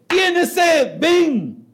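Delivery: high, loud shouted call across a crowd; loud preaching call. Acted. Preacher shouting to the crowd, loud call. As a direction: cry out over the noise of the temple. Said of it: "Yo no voy a gritar".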